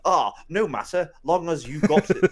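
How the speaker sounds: mock posh English accent like Hugh Grant; Hugh Grant impression. Friend mocks the captain line, posh English accent. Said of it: "You turned me into Hugh Grant".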